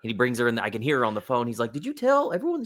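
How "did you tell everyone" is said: very high, disbelieving question; husband confronting his wife; incredulous husband. Retold or imitated.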